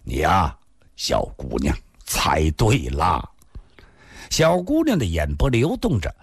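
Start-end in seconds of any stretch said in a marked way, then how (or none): 0.0-3.2 low, indulgent male character; playful low voice, slowing at the end; teasing older man. A narrator's reading. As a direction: drop low and draw out the teasing answer. Man teasingly confirms her guess.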